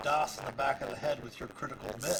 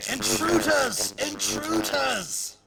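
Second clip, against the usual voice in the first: loud, high drawn-out alarm cry; stretched-out shouted warning; shouting; 11 semitones higher, 7 dB louder, much slower than usual. Acted. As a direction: shout it long and high as the creature raising the alarm. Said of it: "starts shouting".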